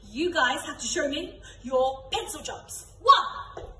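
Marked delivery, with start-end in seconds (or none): 3.0-3.7 a game caller's excited call, much higher and louder; called command. Shown as a demonstration. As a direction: shout the number high and loud. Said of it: "if I say the number one".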